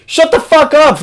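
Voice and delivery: high-pitched exasperated snap; exasperated outburst. Real, not acted. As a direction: blurt it out, fed up. Ranting at the chat guy.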